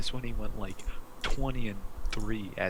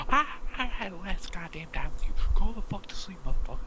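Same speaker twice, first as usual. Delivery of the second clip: exasperated parent; 10 semitones higher than usual. Retold or imitated.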